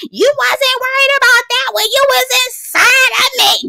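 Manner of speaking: very high-pitched imitation of yelling; mock yelling. Woman yelling across the table at her lover, mock yelling. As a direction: mimic a woman hollering in a squealing high voice. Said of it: "heard your ass yelling".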